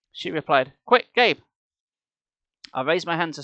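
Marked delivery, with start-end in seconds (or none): 0.9-1.4 higher, louder urging; girl urges Gabe, louder and higher; urgent. A narrator's reading. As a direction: hurry him along.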